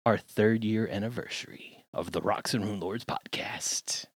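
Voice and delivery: hushed stage whisper. Acted. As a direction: whisper it like a secret. Secret-sounding anniversary reveal.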